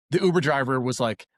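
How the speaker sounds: usual speaking voice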